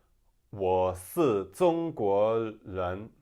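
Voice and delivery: very slow, loud southern accent, si, zong, len; southern Chinese accent, spell out each changed sound; southern China accent. Shown as a demonstration. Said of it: "it might be".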